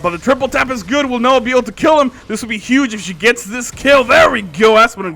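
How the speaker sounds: loud, high tense commentary; excited. Real, not acted. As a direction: cheer her on to the kill. Tense kill chase.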